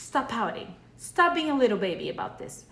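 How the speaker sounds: a hint of a scolding mom; stern parent scolding. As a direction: play the tough-love parent telling the kid off. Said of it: "mom would say or dad would say".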